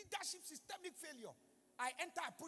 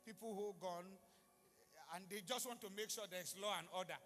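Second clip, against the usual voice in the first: normal talking voice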